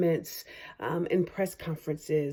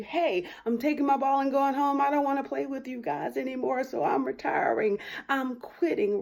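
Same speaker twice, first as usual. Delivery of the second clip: higher, mock-childish sulk; sulky quitter; 7 semitones higher than usual. Emotions telling a rapper to quit, in a hint of a sulky child. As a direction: play the sore loser taking his ball home. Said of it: "your emotions that are telling you".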